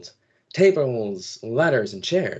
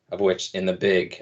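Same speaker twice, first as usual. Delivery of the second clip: normal talking voice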